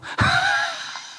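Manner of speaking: sudden squealing laugh; high-pitched laugh; high burst of laughter. Real, not acted. Laughs after joke.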